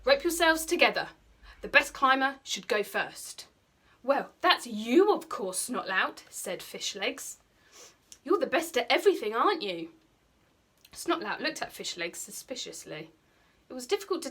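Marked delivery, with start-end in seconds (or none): 0.0-3.5 louder, higher bossy order; commanding. Acted. Snotlout commands the boys. 4.0-6.2 teasing, slow and louder; butter him up with a sly edge; sly flattery. Acted. Fishlegs flatters sarcastically. 8.2-9.9 quick, louder mock praise; sly flattery. Fishlegs mocks Snotlout.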